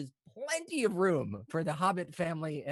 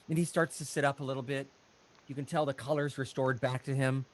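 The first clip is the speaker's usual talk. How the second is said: normal talking voice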